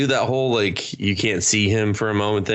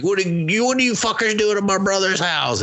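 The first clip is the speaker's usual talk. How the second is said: high mock indignant yell; mock angry brother; 12 semitones higher than usual. Brother confronting strangers at the house, high mock yell. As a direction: demand answers, hammed-up. Character yelling at intruders.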